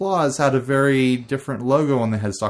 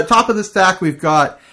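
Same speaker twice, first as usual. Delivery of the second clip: booming DJ announcer, higher and louder; radio DJ voice; 8 semitones higher, 6 dB louder than usual. Real, not acted. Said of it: "I sound like a radio D J".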